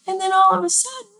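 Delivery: high, quick put-on voice. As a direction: squeeze it up high, rushing a bit. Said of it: "doesn't have to be a higher pitched sound".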